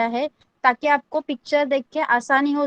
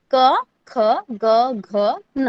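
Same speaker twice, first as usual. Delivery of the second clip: क ख ग घ न drawn out one by one; slow recitation; much slower than usual. Shown as a demonstration. Teacher reciting the first row slowly to class. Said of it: "कैसे पढ़ना है?".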